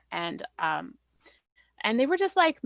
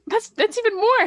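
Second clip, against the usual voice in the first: excited protest; 10 dB louder than usual. Real, not acted. Laughing protest at the bigger number.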